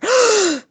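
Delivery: sharp high gasp of horror; horrified gasp. Acted. Guests seeing the empty box, a shocked gasp.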